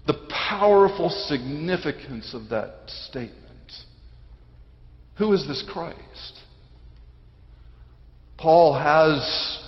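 usual speaking voice

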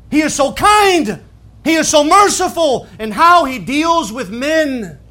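loud, high, fervent preaching; preaching at full force. Real, not acted. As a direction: build and proclaim God's mercy at full voice.

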